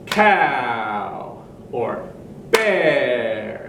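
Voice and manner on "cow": "cow" stretched as one long beat; long sound. Shown as a demonstration. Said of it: "one long sound".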